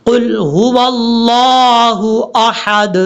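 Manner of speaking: slow, drawn-out Quranic chant; slow tajweed recitation of the verse. Shown as a demonstration. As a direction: recite قل هو اللہ احد slowly and melodically, every letter stretched. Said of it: "یہ ہے اس کا پڑھنے کا طریقہ".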